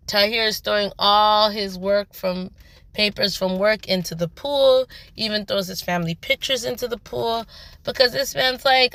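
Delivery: ordinary talk, nothing marked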